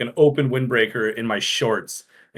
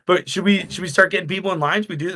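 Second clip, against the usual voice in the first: eager push; 6 semitones higher, faster than usual. Retold or imitated. Pushing coworkers to open.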